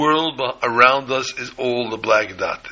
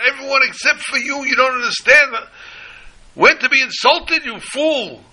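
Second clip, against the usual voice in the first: high, loud furious screaming; 7 dB louder than usual. Retold or imitated. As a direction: scream the insults with everything you've got.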